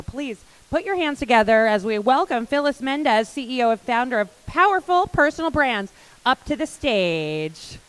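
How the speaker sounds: loud, ringing stage announcement, stretching the last words; host calling the speaker up to a cheering room; announcer welcome. Real, not acted. Host welcoming the CEO onstage, big booming announcer call.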